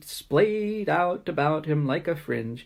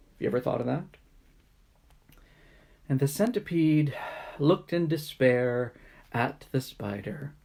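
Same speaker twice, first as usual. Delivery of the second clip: ordinary delivery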